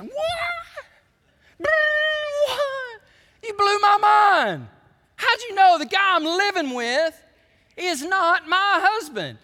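high, loud, drawn-out disbelief; blown-away and overplayed, voice shooting up; hammed-up astonishment. Woman stunned that Jesus knows about her, hammed-up astonishment.

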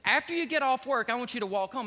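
booming pulpit storytelling; preaching at full force. Acted. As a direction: preach it out to the whole church. Preacher retells father's words.

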